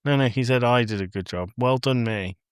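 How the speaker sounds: usual speaking voice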